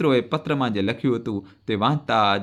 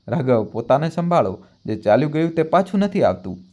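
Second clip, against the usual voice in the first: plain reading voice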